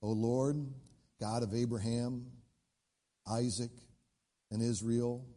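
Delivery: low prayer with long pauses; solemn prayer. Retold or imitated. Elijah stepping forward to pray to God, solemn prayer. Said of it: "steps forward and he says".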